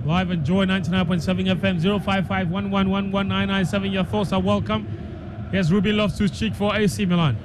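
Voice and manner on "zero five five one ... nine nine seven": usual speaking voice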